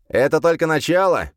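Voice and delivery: high, loud smirking demon voice. Acted. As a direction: sneer it like a gloating demon. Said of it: "усмехнулся демон".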